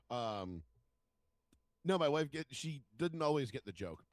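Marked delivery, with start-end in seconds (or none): none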